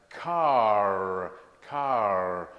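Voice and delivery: car, car drawn out slowly with a strong final R; slow clear R. Shown as a demonstration. Teacher drilling "car" slowly for learners. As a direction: say "car" slowly and loudly, sounding the R at the end. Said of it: "I'm emphasizing by speaking very slowly".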